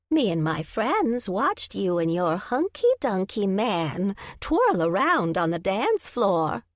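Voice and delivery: slow, sly granny gossip; teasing old lady. Acted. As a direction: tease slowly like a nosy granny.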